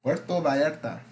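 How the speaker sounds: Puerto Vallarta in a Spanish accent, rolled r. Shown as a demonstration. Host showing off his Spanish accent on Puerto Vallarta. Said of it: "I got a good Spanish accent".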